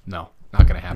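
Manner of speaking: low, loud mock parent flat refusal; parents refusing. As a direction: bark the refusal like a strict dad. Parents refuse in retold story.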